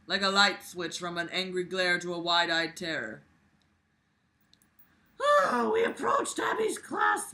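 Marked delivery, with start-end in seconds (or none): 5.2-7.3 very high, loud mock wail; hammed-up wail. Reading Abby's story as an overblown wail. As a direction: cry it out like a melodrama.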